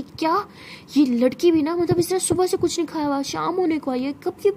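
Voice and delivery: high, indignant shock; shocked scolding. Acted. Aunt learns the girl hasn't eaten all day, shocked and scolding. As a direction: gasp it out, then scold in disbelief.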